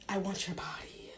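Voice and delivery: breathy seductive whisper; a hushed bedroom whisper. Acted. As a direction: whisper it close in his ear. Psyche whispers to husband.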